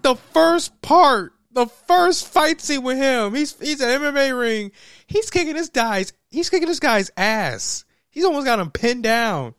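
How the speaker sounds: worked-up movie rant; excited rant. Real, not acted. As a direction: rant about the fight scene, fired up. Excited rant about fight.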